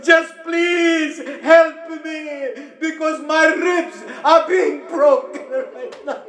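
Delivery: broken crying plea. Acted. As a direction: beg through pain. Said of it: "And Sakina says".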